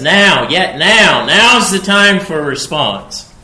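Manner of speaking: loud, high, drawn-out call to act now; urgent proclaiming. Acted. Prophet urging the discouraged people, loud and high. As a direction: press the people hard on now.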